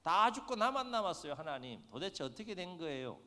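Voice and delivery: high, loud cry of complaint; loud, anguished appeal to God; desperate plea. Retold or imitated. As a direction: cry out that you alone are left. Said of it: "하나님 앞에 하소연해요".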